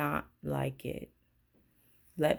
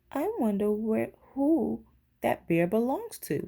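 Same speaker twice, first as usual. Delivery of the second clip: lightly voiced artist, a bit higher; a hint of a curious artist; artist's voice; 5 semitones higher than usual. Artist musing about the bear, lightly voiced.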